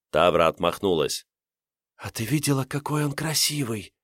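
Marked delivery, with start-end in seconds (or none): 2.0-3.9 soft dreamy breathy whisper. Acted. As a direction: whisper it in awe, lovestruck. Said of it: "прошептала с придыханием".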